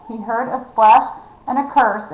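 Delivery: ordinary delivery, nothing marked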